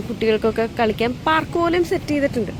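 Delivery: ordinary talk, nothing marked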